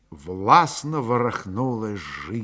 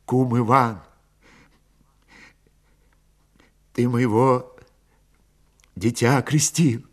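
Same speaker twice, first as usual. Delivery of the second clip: halting plea with long pauses; understated appeal for mercy, broken by silences; subdued plea. A narrator's reading. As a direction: beg haltingly, without raising the voice. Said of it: "попросил Петро".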